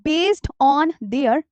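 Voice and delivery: very slow, drawn-out dictation pace. Shown as a demonstration. Teacher dictating a heading, very slowly. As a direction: stretch each word out so they can write it.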